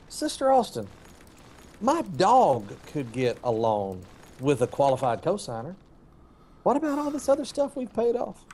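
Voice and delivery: high, exasperated protest; indignant outburst. Retold or imitated. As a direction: blurt it out, high and incredulous. Retold outburst at loan officer.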